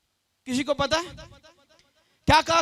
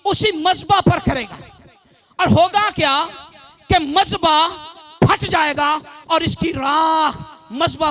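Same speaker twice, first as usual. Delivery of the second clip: forceful, raised prophetic proclamation; preaching; 2 semitones higher, 5 dB louder, slower than usual. Real, not acted. Preacher proclaims prophecy of Josiah, forceful drawn-out preaching. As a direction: hit the opening words hard, pause after each phrase.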